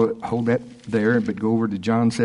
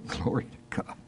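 whispered praise; 12 dB quieter than usual. Real, not acted. Preacher overcome, whispered praise.

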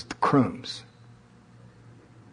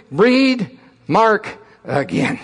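drill sergeant; 5 semitones higher, much slower than usual. Retold or imitated. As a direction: spell it out like a drill instructor. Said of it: "drill instructor".

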